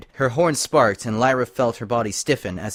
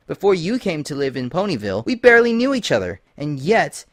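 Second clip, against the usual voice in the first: higher, faster and louder on barely knew; suspicious Twilight; 6 semitones higher, 4 dB louder than usual. Acted. Twilight pressing Lyra with suspicion, higher and faster. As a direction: build the accusation with rising alarm.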